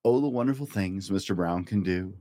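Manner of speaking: ordinary delivery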